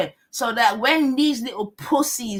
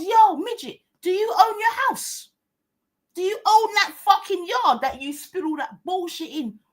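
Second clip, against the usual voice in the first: higher-pitched, retold confrontational questioning; retold challenge; 6 semitones higher than usual. Retells confronting the man.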